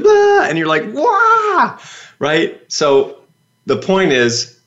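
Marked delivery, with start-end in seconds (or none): 0.0-0.4 high, loud belted mock note; imitated singing burst. Imitating Susan suddenly coming out with her voice, belting. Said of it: "she came out with this". 0.9-1.8 very high-pitched wail of astonishment; amazed "wah". Retold or imitated. Audience reacting to Susan's surprise voice, amazed high cry.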